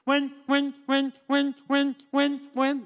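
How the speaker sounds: high, loud stammering on buen; stuttering voice. Acted. Joke teller playing the stuttering man answering the phone. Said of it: "Contestó el vato".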